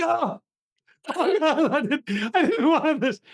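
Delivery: high, laughing mock sob; choked up. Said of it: "I start getting choked up and emotional".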